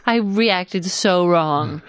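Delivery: ordinary delivery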